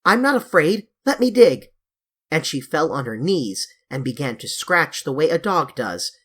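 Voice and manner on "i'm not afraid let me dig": high, loud, eager cry. A narrator's reading. Girl crying out to the boys to let her dig, high and loud. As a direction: insist on digging, full voice. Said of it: "Anthea cried out".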